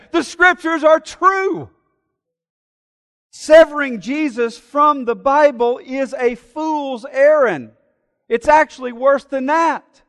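fiery sermon climax; full-force preaching. Acted. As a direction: drive the point home from the pulpit.

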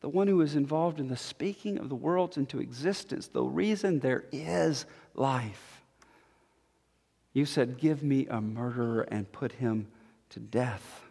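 low, soft, solemn and measured; hushed preaching. Real, not acted. Preacher explains in hushed gravity.

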